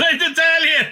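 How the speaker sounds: high and loud, laughing through the words; amused apology through laughter. Real, not acted. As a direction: laugh it out as you break the news. Laughing while giving bad news.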